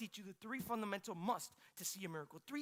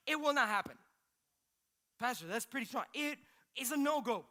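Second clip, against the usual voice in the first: louder preaching with building force; 4 semitones higher, 7 dB louder than usual. Acted. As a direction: drive it home to the congregation.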